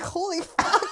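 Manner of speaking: shocked exclamation. Retold or imitated. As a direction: blurt it out like the stretch caught you off guard. Retelling stretch on trainer's table.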